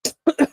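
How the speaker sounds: sharp high cough; real throat-clearing cough. Real, not acted. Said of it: "Sorry about that".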